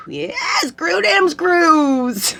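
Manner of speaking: very high, very slow holler; catcalling builders from a car, high and drawn out; mock catcall. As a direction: stretch the tease out like a catcall. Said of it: "driving by construction sites going".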